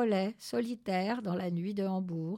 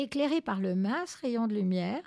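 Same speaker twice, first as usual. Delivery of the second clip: usual speaking voice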